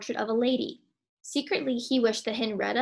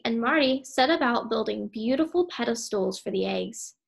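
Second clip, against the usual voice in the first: ordinary delivery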